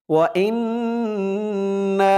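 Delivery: ওয়া ইন্না with the nn hummed through the nose; ghunna on ইন্না. Shown as a demonstration. Teacher demonstrating obligatory ghunna, nasal hum on nn. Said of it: "এখানে ওয়াজিব গুন্না হবে".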